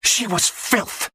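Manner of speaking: slow, high, venomous spit of words; a hateful jeer; spat. Acted. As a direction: spit it with contempt. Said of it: "Godwin spat at me".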